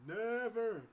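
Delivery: high, loud, final; firm loud never. Real, not acted. Telling viewers he won't see her again, a loud never. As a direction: shut the idea down completely. Repeating refusal.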